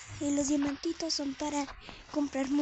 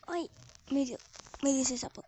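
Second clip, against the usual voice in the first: ordinary delivery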